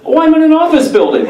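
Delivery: high, fast and laughing; breezy father on the phone; cheery dad. Retold or imitated. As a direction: play the carefree dad without a care. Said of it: "my dad over the phone goes".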